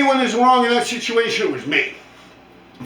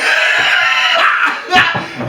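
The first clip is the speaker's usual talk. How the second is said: loud, wheezing laugh; real breathless cackle; hard laughing; 8 semitones higher, 5 dB louder than usual. Real, not acted. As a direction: burst out laughing mid-story. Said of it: "I'm laughing so fucking hard".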